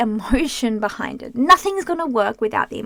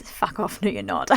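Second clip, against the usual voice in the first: snide inner voice; 5 semitones lower, 5 dB quieter than usual. Retold or imitated. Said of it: "the next thought in your mind is".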